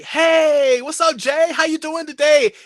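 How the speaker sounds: loud, high acted hail; exuberant greeting. Star greeting the actor on set, exuberant. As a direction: call out a big hello across the set.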